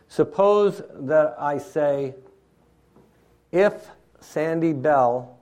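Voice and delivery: deliberate setup. Shown as a demonstration. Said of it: "slow setup of an illustration".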